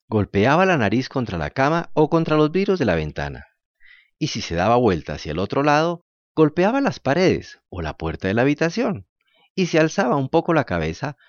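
usual speaking voice

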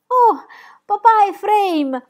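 high, loud, drawn-out shout to papa; overjoyed child's shout; joyful cry. A narrator's reading. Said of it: "gridò Pippi".

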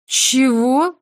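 высокий громкий вскрик; как громом поражённая; изумлённо. A narrator's reading. Мари в шоке. (Мари в шоке от Симона, изумлённо)

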